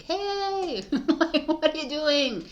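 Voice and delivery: mock inner voice snapping you awake; inner voice calling out. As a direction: voice the inner self shouting hey. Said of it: "big voice inside you that's like".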